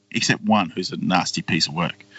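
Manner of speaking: normal talking voice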